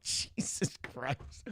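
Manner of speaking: slow whispered Jesus Christ; exasperated whisper. Retold or imitated. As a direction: whisper it slowly, fed up. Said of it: "I'm like".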